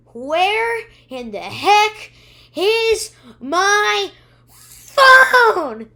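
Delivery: imitating a drawn-out high yell; Brooklyn's furious yell. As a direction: shout it slowly, stretching every word. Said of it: "almost exactly what she does".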